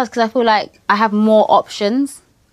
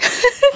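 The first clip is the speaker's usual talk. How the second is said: tearful laugh; 4 dB louder than usual. Real, not acted. Crying guest laughs at herself.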